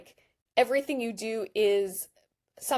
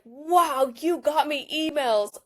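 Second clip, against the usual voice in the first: mock delighted user; 5 semitones higher, slower than usual. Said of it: "they kinda should take the W here".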